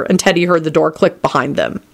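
ordinary delivery, nothing marked

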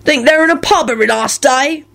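high-pitched indignant retort; aggrieved rogue protesting; angry. A narrator's reading. Mundungus arguing back at Lupin, angry. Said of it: "he responded angrily".

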